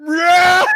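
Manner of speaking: high, loud put-on scream; mock movie scream. Acting the screamer performing for his friends, mock scream. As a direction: belt out a famous film scream in jest. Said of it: "do the scream".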